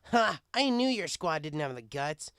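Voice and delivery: loud mocking jeer; brash thug taunt. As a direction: rub their noses in it.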